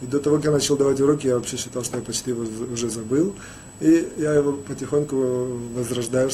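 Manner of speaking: ordinary talk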